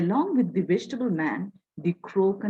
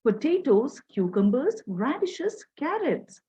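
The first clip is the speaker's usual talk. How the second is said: higher-pitched street vendor's cry, lightly acted; vegetable seller calling out; 6 semitones higher than usual. A narrator's reading. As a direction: lightly call out wares to customers. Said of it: "calls out to his customers".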